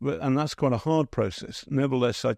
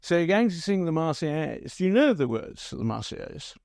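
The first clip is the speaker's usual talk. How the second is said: higher-pitched posh BBC reporter's question; 5 semitones higher than usual. Retold or imitated. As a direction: put on a posh reporter asking a supporter. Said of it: "and the posh guy says".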